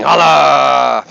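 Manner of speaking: loud drawn-out holler; calling out. Pretend. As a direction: belt it out and hold the last vowel. Catchphrase call to the crowd.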